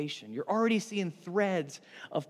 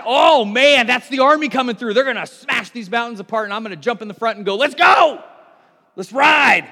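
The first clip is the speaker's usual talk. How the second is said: higher and much louder, building to a shout; eager battle cry; 5 semitones higher, 13 dB louder than usual. Pretend. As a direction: [name] charging to the front, shouting to ride. [name] imagines charging with army.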